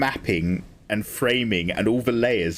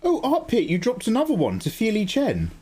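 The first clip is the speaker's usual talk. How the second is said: delighted; 9 semitones higher than usual. Real, not acted. Thanks a gifter for another gift.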